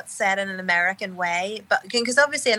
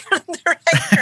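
laughing; 10 semitones higher, 7 dB louder than usual. Real, not acted. Laughing at her own Irish.

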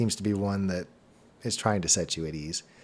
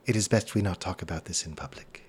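hushed stranger; 2 semitones lower, 4 dB quieter than usual. Acted. Stranger urges discretion.

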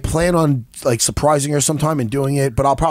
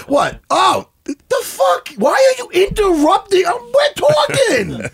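mock outrage. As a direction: play up being interrupted.

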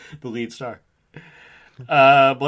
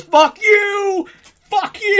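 high, loud imitation of a movie meltdown; freaked-out yell; 7 dB louder, much slower than usual. Fan imitating Cage freaking out in the film, high mock shout. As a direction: scream it like an actor losing control. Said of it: "just freaks out and goes".

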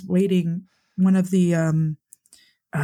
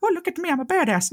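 much higher, quick show-off voice; mock bragging; 12 semitones higher, faster than usual. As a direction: put on a hammed-up braggart, high and fast. Mocks the braggart they are not.

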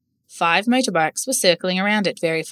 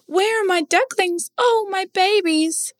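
very high, slow, anxious duck voice; fretting mother duck; 12 semitones higher, slower than usual. A narrator's reading. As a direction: cry out for lost babies. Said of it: "a mother duck fretted".

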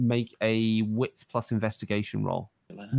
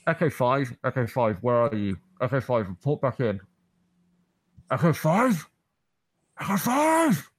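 crackly radio call, growing more urgent; radio crackling; 2 semitones higher than usual. Acted. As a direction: call over a crackling radio, rising in pitch as no one answers. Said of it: "radio crackling".

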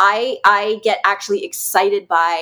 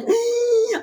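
high startled gasp, acted out; mock gasp of a nervous client; imitated gasp; 13 semitones higher than usual. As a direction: mimic someone's shocked intake of breath.

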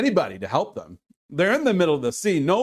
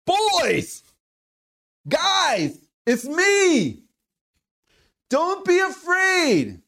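high, drawn-out shout across the water; acted reassuring holler, pitched high; calling out; 10 semitones higher, much slower than usual. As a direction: call out to frightened friends from far off. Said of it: "it starts to call out to them".

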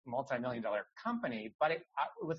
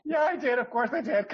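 laughing; 10 semitones higher, 8 dB louder than usual. Real, not acted. Laughing emphatic admission.

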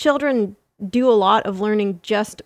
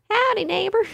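much higher squeaky Southern greeting; a baby whale drawling hello in a Southern accent; 12 semitones higher, faster than usual. Pretend. Baby whale greeting a neighbour, high Southern accent. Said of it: "imagine all sperm whales as having a southern accent".

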